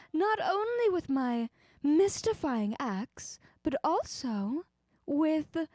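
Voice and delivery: put-on old Hollywood transatlantic accent; high, airy old Hollywood transatlantic accent. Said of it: "Pluvia suggested a transatlantic accent".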